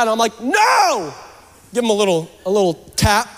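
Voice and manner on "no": high, drawn-out scolding "no"; mock angry yell. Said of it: "I get all mad".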